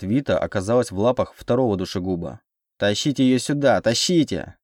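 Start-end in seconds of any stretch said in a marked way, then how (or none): 2.8-4.6 mildly voiced triumphant order; a touch of villainous triumph; triumphant singsong. A narrator's reading. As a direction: gloat as you call them over. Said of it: "триумфально пел князь этой помойки".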